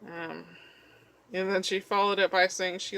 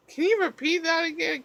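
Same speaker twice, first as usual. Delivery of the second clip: baffled question; 10 semitones higher, 4 dB louder than usual. Retold or imitated. Confused reply to sleeping mom.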